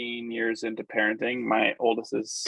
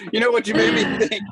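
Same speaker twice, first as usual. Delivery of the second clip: high, loud and fast through real laughter; talking through a delighted laugh; laughing while talking; 10 semitones higher, 7 dB louder, faster than usual. Real, not acted. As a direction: push the words out through the laugh. Host still laughing, excited.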